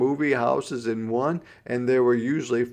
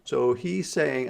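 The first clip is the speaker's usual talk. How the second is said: usual speaking voice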